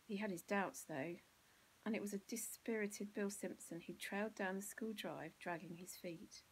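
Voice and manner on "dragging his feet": plain reading voice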